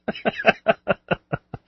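high, breathless laughing fit; long amused laugh; helpless laughter. Real, not acted. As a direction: crack up laughing. Cracks up at chat question.

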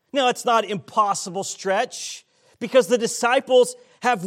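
loud, high preaching. Acted. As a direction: preach it with full force. Preaching builds to full force.

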